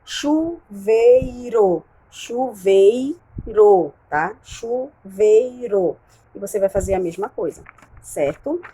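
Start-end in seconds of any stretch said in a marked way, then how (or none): 0.0-3.9 Chuveiro said very slowly, chu-vei-ro, each syllable apart; syllables drawn out. Shown as a demonstration. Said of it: "vai colocar C pra consoante e V". 4.4-6.0 Chuveiro said very slowly, chu-vei-ro, each syllable apart; syllables drawn out. Shown as a demonstration. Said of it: "vai colocar C pra consoante e V".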